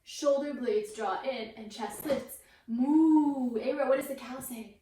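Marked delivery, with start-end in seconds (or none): 2.7-3.6 long, louder playful moo; cow moo. Pretend. Teacher showing cow pose to her toddler, drawn-out mooing. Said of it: "Cow goes moo".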